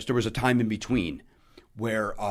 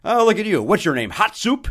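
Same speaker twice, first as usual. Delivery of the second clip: Rickles insult; 9 semitones higher, 8 dB louder than usual. Retold or imitated. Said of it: "I'd never heard him say it before".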